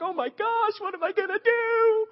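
shrill panicked squeal, high up; mock panic. Preacher mocking himself over one enemy, high panicked squeal. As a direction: squeak it out like a terrified kid. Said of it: "I'm like".

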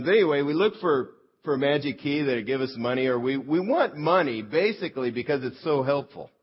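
loud, full-force preaching. Real, not acted. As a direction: drive the point home from the pulpit.

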